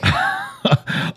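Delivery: a sudden high-pitched laugh, then quiet; high delighted laugh; high squealing laugh. Real, not acted. Laughs at wife's jab.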